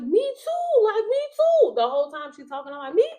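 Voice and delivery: squealing high, eager me too; like someone finally gets it; excited me too. Retold or imitated. Hearing her mom's testimony, a high excited me too.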